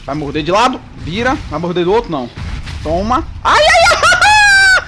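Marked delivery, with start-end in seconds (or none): 3.4-4.8 high, loud laughing squeals; real giggling shriek at the boss. Real, not acted.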